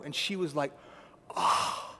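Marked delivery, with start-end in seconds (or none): 1.3-1.9 breathless appalled gasp of a word; a whispered, shocked oh. Retold or imitated. Lady stunned by the request, appalled whispered oh. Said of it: "Just appalled".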